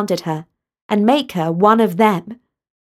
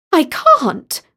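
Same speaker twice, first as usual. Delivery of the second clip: protesting; 9 semitones higher than usual. Acted. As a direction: refuse in a fluster. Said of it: "protested Talia".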